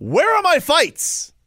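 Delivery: high, stretched-out indignant cry; indignant demand. Real, not acted. Calling out the promoter who promised Florida fights, indignant demand. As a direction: draw it out high and aggrieved.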